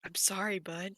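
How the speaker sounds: soft, near-whispered apology; a quiet, consoling hand-on-shoulder voice; hushed sad news. Acted. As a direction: break the bad news gently and quietly. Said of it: "and he's like".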